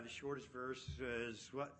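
ordinary talk, nothing marked

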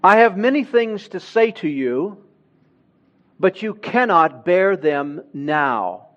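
ordinary talk

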